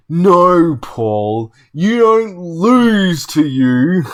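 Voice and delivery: loud, high, drawn-out retort; slow, indignant shout at his opponent; mock raging. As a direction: imitate a raging sore loser. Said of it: "say to a raging person".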